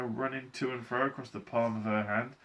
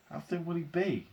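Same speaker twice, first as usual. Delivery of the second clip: high, worried question; anxious mother, pitched up; 8 semitones higher than usual. A narrator's reading. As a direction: ask with mounting worry. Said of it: "asked Missus T V anxiously".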